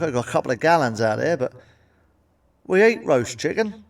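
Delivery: normal talking voice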